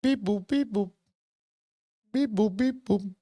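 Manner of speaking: high mechanical beep-boop robot voice. Pretend. Streamer pretending to be a robot for the captcha, mock robot beeping. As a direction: play the robot proving it's not one. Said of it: "making the bebop, bebop noises".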